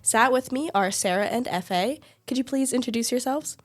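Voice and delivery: usual speaking voice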